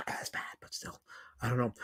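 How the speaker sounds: trailing off into a near whisper. Real, not acted. Streamer unsure about a chat name, whispered trail-off. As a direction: let it die away under your breath.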